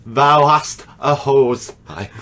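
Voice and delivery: vicar voice. Retold or imitated. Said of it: "that vicar ... started reading".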